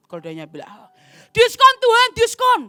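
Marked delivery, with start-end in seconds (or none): 1.3-2.6 high, loud begging for a discount; squeaky eager haggling; pleading haggle. Acted. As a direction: beg God like a market haggler. Donkey begging God for discount.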